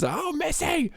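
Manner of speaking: high sing-song call, falling at the end; grandma's cadence. Retold or imitated. Grandma calling her cat Missy, high lilting cadence. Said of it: "the cadence she would say it with was".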